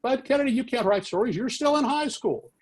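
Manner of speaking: very high-pitched outrage; madder and madder. Retold or imitated. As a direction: imitate a boss losing his temper. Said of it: "He got madder and madder".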